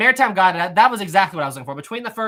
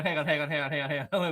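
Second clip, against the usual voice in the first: ordinary talk, nothing marked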